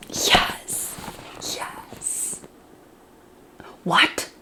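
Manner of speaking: breathless whispered yes; barely voiced disbelief; a hushed stunned whisper. Real, not acted. Reacting to a plot twist.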